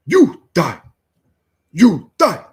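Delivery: high, loud put-on African accent battle cry; mock African witch doctor. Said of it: "playing the witch doctor on Diablo".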